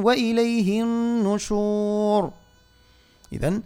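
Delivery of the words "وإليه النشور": high, slow chanted tajweed recitation; Quran recitation. Shown as a demonstration. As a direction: intone the verse in full, slowly. Recites verse in full. (تلاوة مجودة مرتفعة)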